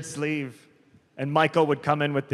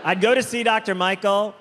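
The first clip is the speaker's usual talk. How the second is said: ordinary talk, nothing marked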